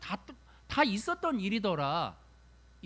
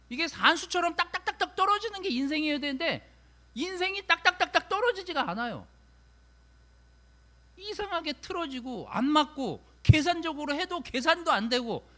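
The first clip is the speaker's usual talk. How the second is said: much higher and louder, urgent and insistent; fervent preaching; 8 semitones higher, 6 dB louder than usual. Acted. Preacher urging the congregation that life doesn't add up, fervent. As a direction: drive the point home.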